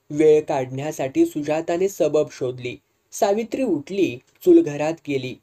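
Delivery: usual speaking voice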